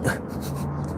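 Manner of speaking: soft, breathy chuckle; quiet laugh escaping mid-thought; real laugh. Real, not acted. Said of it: "sorry, I'm laughing about this".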